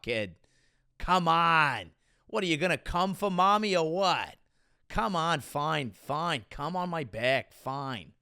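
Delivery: hammed-up Boston local; Boston accent. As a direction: play a crude Bostonian, trailing into resignation. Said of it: "only know how to say a few words in it".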